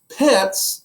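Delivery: high, loud incredulous "Pets?"; a wife appalled at the idea. Acted. Wife balks at a pet.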